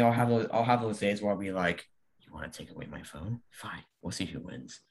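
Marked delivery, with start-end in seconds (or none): 2.2-4.8 low, soft, threatening mutter; quiet menace. Retold or imitated. To mom who took away his phone, a quiet menacing threat. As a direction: simmer quietly like a villain plotting revenge.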